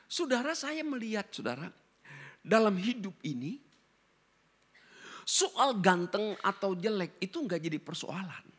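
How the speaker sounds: usual speaking voice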